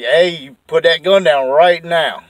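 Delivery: firm command. Retold or imitated. Facing the drunk girlfriend holding a pistol, slow firm order. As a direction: tell her to drop it, slow and firm.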